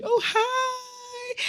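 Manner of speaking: high squealy excited greeting; over-keen hi. Retold or imitated. Imitating the keen girl, squealed hello. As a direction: gush it like a too-keen newcomer.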